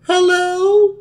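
very high mock falsetto; dude talking like a girl. Man imitating Sasha answering the phone, fake girl voice. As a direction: put on a squeaky fake girl voice. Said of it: "sound like a dude talking like a girl".

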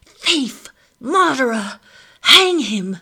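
high, very loud accusing screams; furious yelling. A narrator's reading. Mother accusing the thief before the soldiers, yelling furiously. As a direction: hurl each word at him in rage. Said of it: "she yells".

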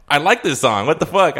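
animated mock astonishment; surprised reaction. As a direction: re-enact pleased disbelief, pitch jumping up. Acted surprise at hearing the song.

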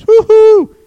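loud high whoop; cheering. Real, not acted. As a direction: whoop it out in celebration. Cheering about vasectomies.

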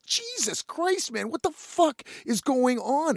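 high, slow, drawn-out dismay; retold helpless exasperation; exasperated disbelief. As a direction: stretch it out in high-pitched bewilderment. Retold exasperation at repeated insult.

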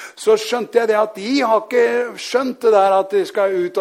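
fervent preaching. Real, not acted. Preacher declares what believers have, fervent proclamation.

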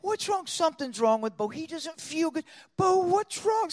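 high-pitched show of innocent worry; feigned concern. Pretend. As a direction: play puzzled and worried for the audience. Feigned innocent concern for squeezed bird.

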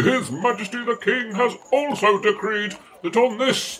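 normal talking voice

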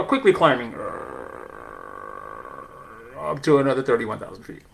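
lightly voiced airline pilot announcement; a hint of cockpit intercom captain. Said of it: "with my best pilot voice".

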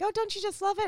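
high mock gushing; gushing neighbour. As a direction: imitate a gushing neighbor asking. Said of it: "everyone's like".

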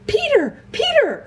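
high-pitched calling; excited cry. A narrator's reading. Edmund calling back to his brother, excited cry. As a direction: call out urgently, pitch leaping up. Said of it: "cried Edmund".

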